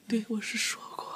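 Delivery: breathy whispered admission. Acted. Mother whispers to daughter, whispering. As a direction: concede under your breath.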